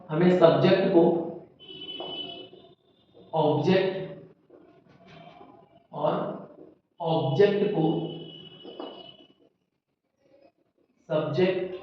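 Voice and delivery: each word set apart by long pauses; teacher dictating a rule, word by word with long gaps; slow dictation. Shown as a demonstration. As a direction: dictate slowly so pupils can write. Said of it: "rule dictated word by word".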